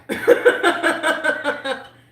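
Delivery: long, high, loud peal of laughter; burst of laughter. Real, not acted. Streamer amused by own box-ticking, loud laughter. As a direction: crack up loudly, pitched high. Amused after another check.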